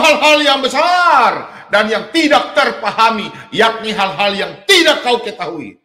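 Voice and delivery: fiery sermon reading; preaching at full force. Acted. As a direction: thunder the verse out to the congregation, slowly. Preacher proclaims scripture verse.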